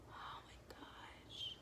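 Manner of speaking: whispered amazement; hushed wow; awed whisper. Real, not acted. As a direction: breathe it out in awe. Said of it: "whispered amazement".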